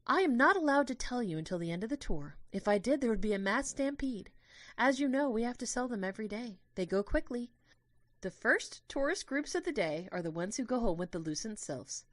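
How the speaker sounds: a hint of a cheerful tour guide; gentle tour guide.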